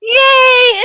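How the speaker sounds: a high, loud cheer of relief; excited cheer. Real, not acted. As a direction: burst out cheering at success. Resin eye stayed in place.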